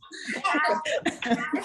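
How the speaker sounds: high, long laugh; delighted laugh. Real, not acted. Performer pleased by the crowd's answer, delighted laugh. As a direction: burst out laughing.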